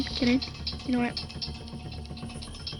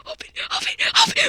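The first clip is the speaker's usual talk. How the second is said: panicked whimper; 13 semitones higher, much faster than usual. Real, not acted. Panicked, door won't open.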